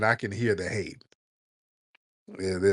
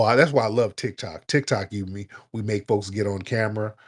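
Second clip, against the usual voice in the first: normal talking voice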